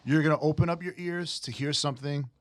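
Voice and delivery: normal talking voice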